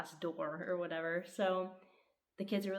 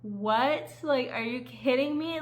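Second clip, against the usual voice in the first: loud, high "what, are you kidding me"; loud outraged disbelief at the ending; stunned disbelief; 6 semitones higher, 9 dB louder than usual. Retold or imitated. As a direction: throw up your hands in disbelief. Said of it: "I was like".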